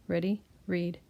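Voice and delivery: ordinary talk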